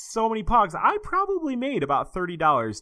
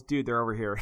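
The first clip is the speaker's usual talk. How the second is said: usual speaking voice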